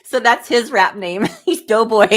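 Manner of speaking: loud words bubbling with laughter; giggling through the punchline; laughing. Real, not acted. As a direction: crack up at your own joke. Laughing at husband's rap name.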